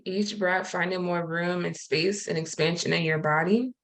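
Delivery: calm, unhurried breathing guidance with long pauses; guided meditation. Acted. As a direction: gently talk the group into stillness and breath.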